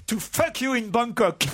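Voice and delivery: mock English accent. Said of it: "Il prend l'accent de Laurel et Hardy".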